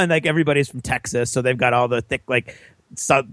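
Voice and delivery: usual speaking voice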